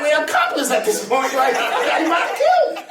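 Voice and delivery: high voice cracking up with laughter; laughing imitation. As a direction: quote the dad's line while laughing. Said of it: "He's like".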